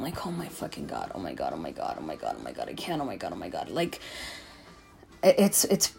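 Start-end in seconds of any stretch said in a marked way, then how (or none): 0.3-3.7 low, hushed string of dread; retold panic. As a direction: mutter it over and over under your breath. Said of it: "I get triggered".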